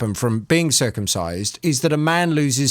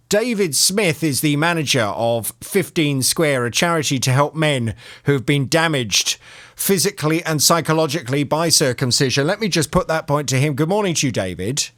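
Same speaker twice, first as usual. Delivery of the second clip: ordinary talk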